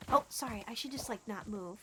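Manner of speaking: ordinary talk, nothing marked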